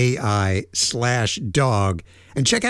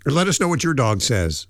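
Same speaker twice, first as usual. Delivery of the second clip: ordinary talk, nothing marked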